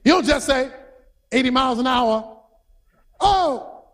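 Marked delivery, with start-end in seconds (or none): 3.2-3.9 high, alarmed interjections; startled driver. Acted. Driver spots police car over the hill, startled. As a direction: yelp as if caught speeding.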